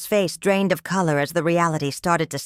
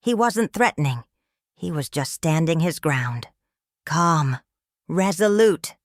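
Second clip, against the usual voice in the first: ordinary delivery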